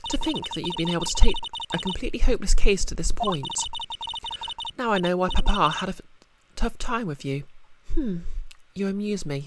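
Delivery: haughty prosecutor. Acted. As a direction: purr mock praise with superiority. Prosecutor role, higher pitch.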